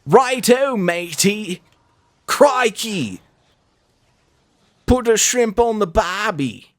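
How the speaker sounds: a cartoon Aussie, Righto matey Crikey; over-the-top Australian accent; mock Australian accent. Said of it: "he's already got the accent down".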